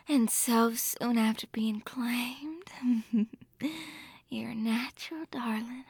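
tender afterglow whisper. Acted. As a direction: whisper it softly, fondly.

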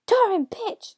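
high, loud startled yell; explosive high outburst; screamed. A narrator's reading. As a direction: yell a curse as if jolted awake. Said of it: "screamed the devil".

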